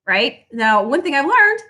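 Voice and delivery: normal talking voice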